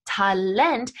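"Talent" the German way, ta-LENT; second-syllable stress on Talent. Shown as a demonstration. Teacher reusing the German loan word, "Talent" stressed ta-LENT. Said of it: "emphasized on that second syllable".

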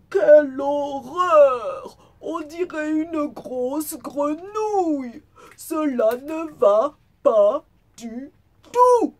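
wolf's loud, high, drawn-out outcry; hammed-up dismay, ending on a high yelped last word; horrified exclamation. A narrator's reading. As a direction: cry out in theatrical horror, slowly. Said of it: "et s'exclama".

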